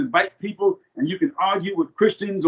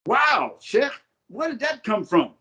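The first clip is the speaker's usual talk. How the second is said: higher-pitched, astonished questioning; challenging disbelief, pitched up; incredulous critics; 7 semitones higher than usual. Retold or imitated. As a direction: quote baffled followers taking you to task. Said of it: "people are saying".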